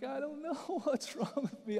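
high, tearful wail, acted out; mock tearful. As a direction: imitate blubbering helplessly through tears. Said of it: "I couldn't stop crying".

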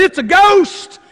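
high, loud cry of alarm; mock-fearful outcry, pitched high; frightened cry. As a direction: call out as the startled disciples. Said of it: "they cried out in fear".

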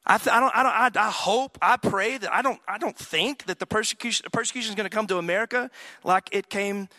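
rising pulpit fervor; preaching. Acted. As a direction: preach it out with fire. Preaching at full force.

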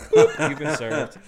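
loud, quick, laughing reveal; spring the prank with a laugh; playful gotcha. Acted. Disguised server revealing the note, loud laughing gotcha.

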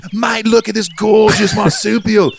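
put-on high, slow, sing-song Australian accent; Crocodile Hunter. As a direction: gush like an excited Australian wildlife host. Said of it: "it was the crocodile hunter".

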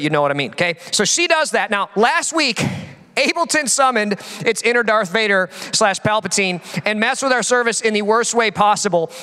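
normal talking voice

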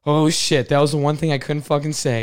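alarmed outburst. Retold or imitated. Coworker realizing he said the forbidden thing, alarmed outburst. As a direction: blurt it out in horror. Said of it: "you're like".

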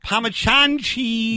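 Parmesan drawn out slowly as "pah-ma-CHAHN"; supposed Italian pronunciation. Shown as a demonstration. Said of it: "That's the way they say it in Italy".

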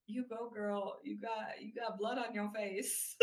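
put-on hushed, teasing "you go, girl"; mock cheer. To Jules with blood on her face, hushed mock cheer. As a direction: cheer her on under your breath. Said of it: "I'm just like".

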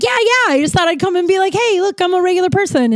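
high-pitched, keen self-imitation; eager explanation. Regular explaining her sober visit to the bartender, eager. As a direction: pitch it up, eager to seem normal.

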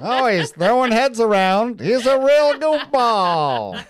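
sports announcer. Pretend. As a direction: call the mascot's entrance to the crowd. Also sounds like a sports mascot.

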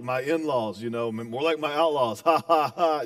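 low-pitched joking imitation with fake laugh; mock in-law joker. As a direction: imitate a corny joker laughing at their own line. Mimics people joking about in-laws.

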